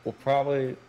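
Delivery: chewing ice while talking; ice in mouth. Real, not acted. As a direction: talk around a mouthful of ice. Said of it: "sorry, I'm chewing on ice right now".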